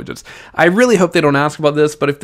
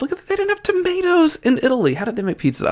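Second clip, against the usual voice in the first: much higher, wide-eyed wonder; amazed disbelief; 13 semitones higher, faster than usual. Real, not acted. Teacher marveling to students that Italy lacked tomatoes, amazed. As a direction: marvel at it like it's unthinkable.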